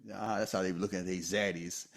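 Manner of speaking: ordinary talk, nothing marked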